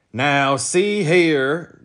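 loud, slow protest; angry growl. A narrator's reading. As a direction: growl it at the officer. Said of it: "growled Talk angrily".

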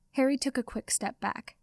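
usual speaking voice

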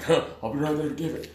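1 imitating an old coworker. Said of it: "one of the old heads is like".

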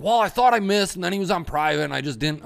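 high, loud whiny excuse voice; imitating Rick. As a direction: do the friend sheepishly excusing himself, way up high. Quoting Rick's excuse.

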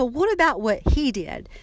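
retold angry demand. Retold confrontation with mother.

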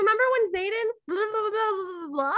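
very high, fast, gushing fangirl; squealy excited friend. Pretend. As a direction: gush about the book, squealing high. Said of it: "be like".

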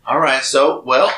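ordinary talk

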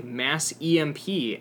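ordinary talk

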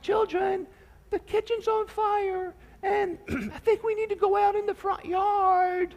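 a mother calling far too sweetly about a fire; timid mom. Retold or imitated. Pastor mimics a too-gentle mom calling kids upstairs, high and mild. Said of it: "she's not gonna go to the steps and say".

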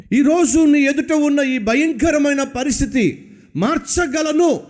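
high, forceful preaching challenge; Jesus challenging. Retold or imitated. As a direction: demand if he truly believes. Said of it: "అంటున్నాడు".

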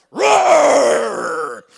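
loud lion roar imitation; mock lion roar. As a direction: roar like a big zoo lion.

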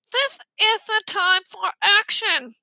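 high, slow objection with a blocked nose; stuffed-nose voice. A narrator's reading. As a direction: object firmly through a pinched nose. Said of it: "they had the worst colds".

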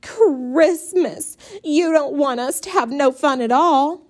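high, loud whining; whining complaint. A narrator's reading. Brenda whining loudly to Mama. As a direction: wail the complaint. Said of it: "stop whining so".

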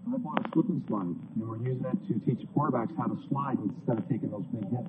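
lightly mocked coach quote; Jim Zorn. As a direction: slip into a hint of a pretend coach voice for the joke. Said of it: "you know what else Jim Zorn had to say?".

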